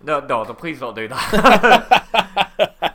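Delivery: high, loud, slow plea through real laughter; laughing plea. Real, not acted. Laughing as he begs his co-host not to take the dare. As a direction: beg them not to, cracking up. Pleading after piss-shot dare.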